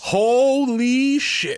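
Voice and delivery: slow, drawn-out high holy shit; real stunned drawl; amazed. Real, not acted. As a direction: stretch it out in disbelief. Hearing five hundred million.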